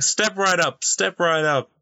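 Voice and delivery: high barker call; carnival barker. Retold or imitated. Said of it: "emulating what he would be saying".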